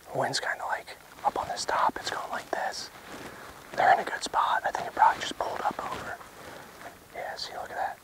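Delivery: hushed field whisper; wildlife watching whisper. Real, not acted. Whispered debrief after stalk.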